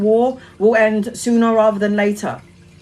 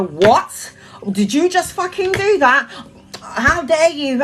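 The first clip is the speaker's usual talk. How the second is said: mock outrage; 5 semitones higher, slower than usual. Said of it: "I would straight away go into the".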